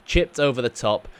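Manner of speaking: usual speaking voice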